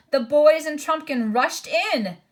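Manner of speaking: ordinary delivery, nothing marked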